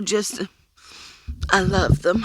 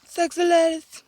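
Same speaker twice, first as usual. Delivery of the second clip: Sexy ladies. lifted higher and sing-song; a higher, teasing take on Sexy ladies; playful high repeat; 8 semitones higher than usual. Shown as a demonstration. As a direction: tease it in a high voice. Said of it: "repeated higher".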